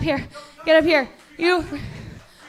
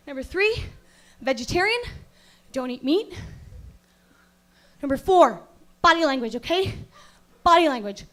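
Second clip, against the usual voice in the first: ordinary delivery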